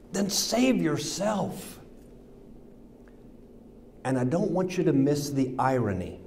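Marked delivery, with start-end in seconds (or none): 0.1-1.7 higher, slow drawn-out 'save yourself' with scorn; slow taunt. Acted. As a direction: stretch it out as a sneering dare. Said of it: "They yelled at him".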